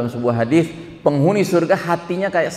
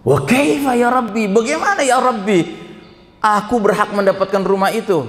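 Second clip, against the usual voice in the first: high, raised voice full of wonder; astonished disbelief; 8 semitones higher than usual. Acted. The servant asking God how he deserves the house, high and astonished. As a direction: cry out in amazement, pitched well up.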